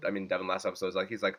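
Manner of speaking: usual speaking voice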